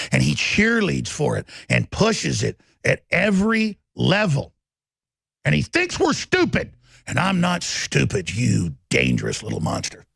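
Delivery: loud, relentless tirade at enemies; furious shouted rant. Real, not acted. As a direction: hammer every line at full volume. Holds a full-force rant.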